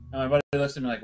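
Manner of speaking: usual speaking voice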